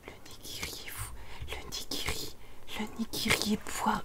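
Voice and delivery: soft, ghostly whisper; hushed, voiceless little chant; whispered taunt. Pretend. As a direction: whisper it like a creepy refrain. Eerie refrain about rotten nigiri.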